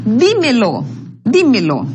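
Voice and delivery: "Dímelo" twice, strong first Dí, falling away; stress on DI. Shown as a demonstration. Teacher demonstrating an esdrújula to students, stressing Dí in "Dímelo". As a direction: hit the Dí of "Dímelo" each time. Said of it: "é di".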